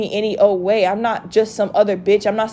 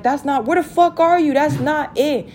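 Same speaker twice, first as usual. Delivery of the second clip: higher-pitched mock scolding; imitated angry cussing; 4 semitones higher than usual. Him cussing at her over where she is, imitated angry scolding. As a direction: retell his exasperated demand. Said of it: "literally cussing at me and all that extra shit".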